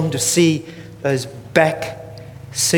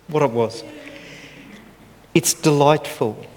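ordinary delivery

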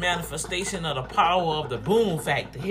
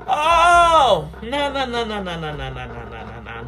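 emphatic no fading out; 4 dB louder than usual. Acted. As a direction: refuse it loudly, then let it fade to nothing. Preacher rejecting the lie.

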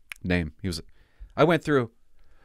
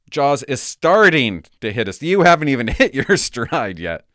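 mock proud read; 3 semitones higher, 8 dB louder than usual. Reading assessment aloud, mocking.

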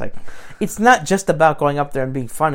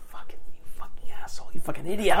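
whispered curse at himself; whispered self-insult; 4 dB quieter than usual. Retold or imitated. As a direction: hiss the insult under your breath. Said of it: "I was like".

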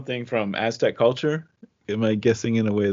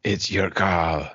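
slow, heavy Austrian action-hero voice; a bad Arnold impression, slowly; Arnold Schwarzenegger; 2 semitones lower, slower than usual. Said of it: "like Arnold Schwarzenegger saying".

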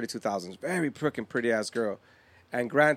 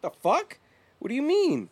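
high, loud retold disbelief; incredulous; 12 semitones higher, 4 dB louder than usual. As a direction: pitch leaps up, baffled and indignant. Acts his disbelief at being asked.

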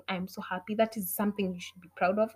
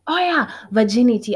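ordinary delivery

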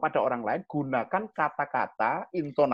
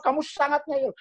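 exasperated scolding; 11 semitones higher than usual. Retold or imitated. Parent scolding a stubborn child harder. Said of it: "Lama-lama".

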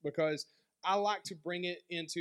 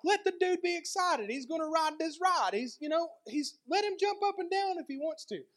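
put-on much higher, pleading on the boy's behalf; exasperated defense; 9 semitones higher than usual. Preacher silently pleading with the mother for her son, high and exasperated. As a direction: stick up for the excited kid. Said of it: "I'm like".

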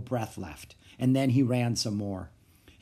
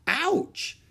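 yelp of ouch. Acted. Boy cries out at swab.

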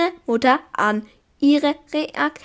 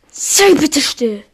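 annoyed; 4 semitones higher, 3 dB louder than usual. Real, not acted. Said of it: "Das irritiert mich".

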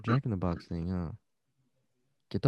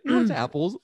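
higher, laughing through it; laughing mock voice; 9 semitones higher, 4 dB louder than usual. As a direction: giggle out the line. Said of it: "And I'm like".

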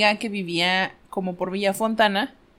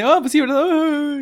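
higher, slow mock fake agreement; dad's polite chuckle; 6 semitones higher, 4 dB louder, slower than usual. Dad politely humoring a bad joke, slow mock agreement. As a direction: drag out a mock polite laugh line. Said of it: "el chistorete papá".

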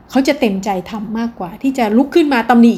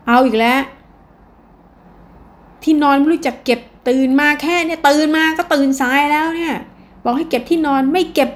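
nagging parent; 5 semitones higher than usual. Acted. Parent nags child about bed.

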